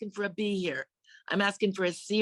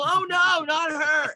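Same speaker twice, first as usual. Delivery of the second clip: high, loud despairing yell; yelling in dismay; 8 semitones higher, 5 dB louder, much slower than usual. Retold or imitated. Teacher yelling as the failing student comes down the hall. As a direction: cry out like a teacher who's had enough. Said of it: "He'd yell".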